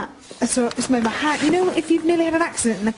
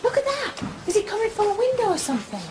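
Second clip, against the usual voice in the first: much higher, laughing excitement; excited; 11 semitones higher, faster than usual. Real, not acted. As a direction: point it out, thrilled and spooked.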